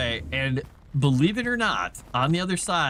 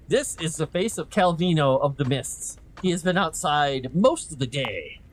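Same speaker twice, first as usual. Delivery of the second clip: usual speaking voice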